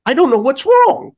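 high, puzzled clerk; high mock store-clerk voice; imitated store lady. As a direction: mimic a baffled lady assistant up high. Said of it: "the lady comes over and she's like".

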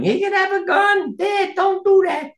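put-on very high, childlike cry of alarm; a scared child begging his father to stop; kids yelling. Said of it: "with our kids yelling".

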